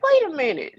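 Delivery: sudden high-pitched surprise; retold high-pitched "wait a minute"; voice shooting up. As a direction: let the voice jump way up in startled recognition. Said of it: "the way his voice, like, shot up".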